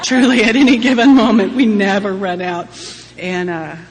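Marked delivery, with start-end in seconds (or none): 0.0-2.7 loud laughing words. Real, not acted. Laughing aloud at the endless Al-Anon tissues.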